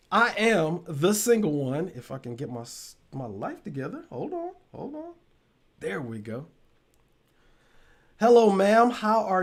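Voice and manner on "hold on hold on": startled hold on. Real, not acted. Tech trouble, startled.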